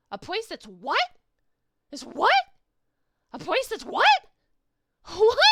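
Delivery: loud, high shouts of "what"; incredulous shouting. Acted. Creature shouts "what" back at the doctor in disbelief. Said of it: "its incredulous shouting of, "What?".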